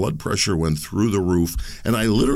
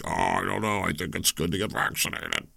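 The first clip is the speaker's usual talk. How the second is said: softer mocking voice of a gullible listener; mock vaccine believer; 7 dB quieter than usual. Host mocking listeners who trust vaccines, in a soft sneering voice. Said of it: "There's people probably listening right now and like".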